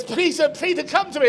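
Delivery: high acted call to a disciple; acted Jesus, pleading from on high. Jesus calls a final time.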